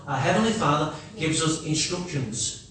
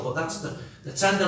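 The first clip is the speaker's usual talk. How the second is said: ordinary delivery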